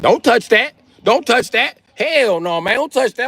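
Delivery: very high-pitched urgent warning; mock alarmed warning. Locals warning him off the frog, high mock alarm. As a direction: imitate alarmed locals, voice shooting way up.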